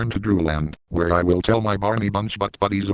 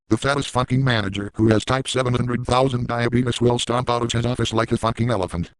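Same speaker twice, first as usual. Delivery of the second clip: usual speaking voice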